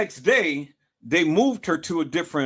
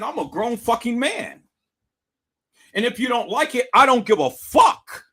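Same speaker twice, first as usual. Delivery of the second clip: higher, heated, rising to a loud finish; defiant anger; 6 semitones higher, 3 dB louder than usual. Retold or imitated. Man snapping back at the pastor, heated and loud. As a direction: throw it back at her.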